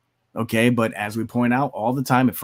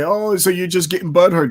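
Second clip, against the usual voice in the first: a mild mocking whine, raised a little; mocking whiny quote; 6 semitones higher, 3 dB louder than usual. Critics mocked as whiny when called out on name-calling. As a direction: mimic their whiny comeback. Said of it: "they'll try to say".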